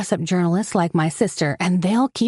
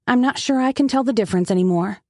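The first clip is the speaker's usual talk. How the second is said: ordinary delivery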